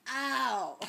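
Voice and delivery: loud, high, drawn-out dawning realization; exaggerated realization. Northerner finally understanding her roommate's phrase, hammed-up loud realization. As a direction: suddenly get it, big and loud. Acts out sudden understanding, then laughs.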